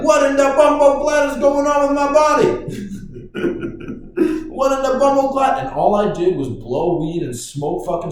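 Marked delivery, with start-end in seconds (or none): 0.0-2.5 loud, high mock Jamaican exclamation; Jamaican accent. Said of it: "I'm like". 2.5-4.4 high laugh. Real, not acted. Laughs at own bit. 4.5-5.6 put-on Jamaican accent. Repeats the Jamaican line.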